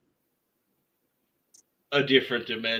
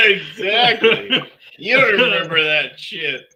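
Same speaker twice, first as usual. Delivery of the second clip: laughing; 8 semitones higher, 8 dB louder than usual. Real, not acted. Laughing fit over drunk memory.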